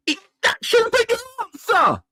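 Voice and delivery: a fan wailing his frustration at the top of his voice; anguished shout. Real, not acted. Fan yelling at the manager's answer, anguished shout.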